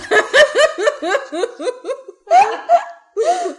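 high, loud burst of laughter; helpless laughing fit. Real, not acted. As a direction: laugh long and hard, unable to stop. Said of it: "she's still dying".

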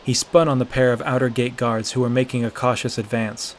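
usual speaking voice

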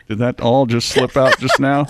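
usual speaking voice

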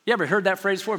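full-force preaching. Acted. Pastor preaching scripture to the church, loud and fervent. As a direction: preach it hard to the congregation.

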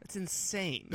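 ordinary talk, nothing marked

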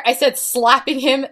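usual speaking voice

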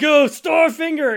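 high, loud battle cry; shout. Retold or imitated. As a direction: yell the attack name. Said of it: "he's like".

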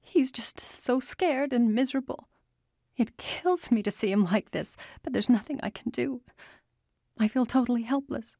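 tearful whisper, rushing; crying. A narrator's reading. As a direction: speak through tears. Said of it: "She started crying".

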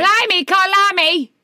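high Cockney exclamation; Cockney accent. Acted. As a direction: cry out in Cockney. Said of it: "your Cockney accent is perfect".

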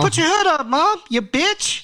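sass the mom way up in pitch; bratty kid. Acted.

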